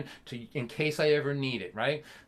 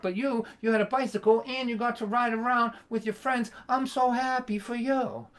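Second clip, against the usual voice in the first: drip the happiness with sarcasm; sarcastic dad; 7 semitones higher than usual. Retold or imitated. Dad sarcastically telling his son he is happy for him.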